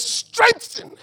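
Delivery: one word flung up high; high swooping Strengthen; soaring word. Acted. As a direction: lift STRENGTHEN high and let it fall. God's word, pitched up.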